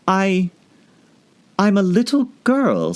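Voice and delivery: put-on high, hesitant girl voice; unsure of herself; doubtful. Alice telling the pigeon, doubtful. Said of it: "rather doubtfully".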